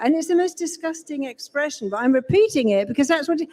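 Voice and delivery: ordinary talk, nothing marked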